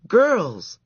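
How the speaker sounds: loud stern call; calling out sternly. A narrator's reading. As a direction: call the girls to order. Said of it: "cried the counselor".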